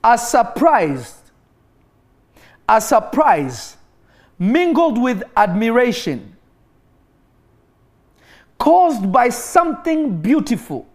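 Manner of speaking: slow, drawn-out dramatic reading; slow emphatic reading. Shown as a demonstration. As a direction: lean on every word, slowly. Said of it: "I will read it one more time".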